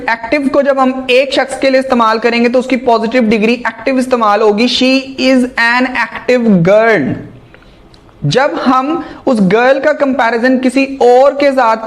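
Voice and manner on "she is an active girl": very slow, word by word example; carefully dictated English sentence; slow dictation. Shown as a demonstration. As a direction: read the example slowly for students.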